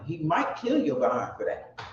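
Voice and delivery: ordinary talk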